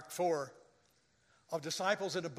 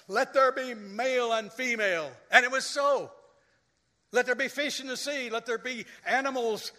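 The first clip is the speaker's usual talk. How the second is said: proclaiming; 6 semitones higher, 5 dB louder than usual. Retold or imitated. God's creation words.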